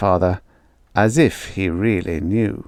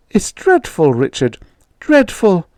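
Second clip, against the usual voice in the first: frightened mother; 9 semitones higher than usual. Acted.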